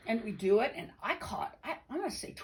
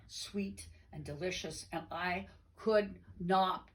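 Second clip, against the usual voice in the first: usual speaking voice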